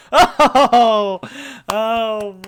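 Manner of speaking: loud gasping relief after the chant; out of breath. Real, not acted. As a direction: gasp for air after the breathless run. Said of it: "I slid into home at the end there".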